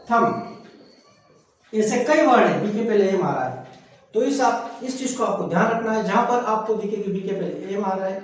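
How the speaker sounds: ordinary talk, nothing marked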